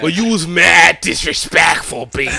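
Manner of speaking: slow, stretched fake northern accent; hammed-up up-north accent; mock up-north accent. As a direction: chop and drag it in an exaggerated up-north accent. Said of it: "'Cause that's how y'all say it".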